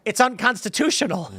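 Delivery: high, mock-deadpan repeat of unconstitutional; mocking Biden. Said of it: "And he goes".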